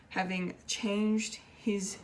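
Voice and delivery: ordinary talk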